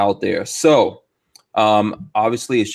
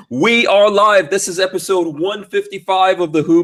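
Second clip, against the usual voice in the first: show-opening announcement; 6 semitones higher, 3 dB louder than usual. Real, not acted. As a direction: announce it big, stretching the words. Podcast host opens the live show.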